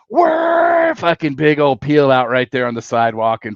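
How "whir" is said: high loud imitated engine whir. Friend peels out on the sidewalk, high engine whir. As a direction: mimic a bike peeling out, much higher.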